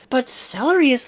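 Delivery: ordinary talk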